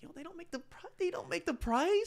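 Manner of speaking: very high-pitched retold protest; mock protest. Bartender answering friends who gripe about prices, high mock protest. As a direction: push the voice way up, stretching the end.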